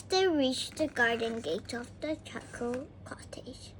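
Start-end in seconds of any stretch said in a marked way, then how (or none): 1.7-3.7 unvoiced whisper. A narrator's reading. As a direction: whisper the name. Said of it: "whisper heard".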